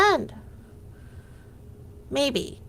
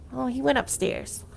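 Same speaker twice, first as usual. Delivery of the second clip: ordinary delivery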